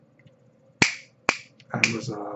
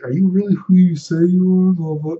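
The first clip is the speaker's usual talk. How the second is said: mocking quote; 7 semitones higher, 7 dB louder than usual. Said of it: "And it's like".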